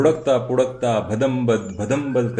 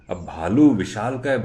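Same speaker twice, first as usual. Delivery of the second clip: plain reading voice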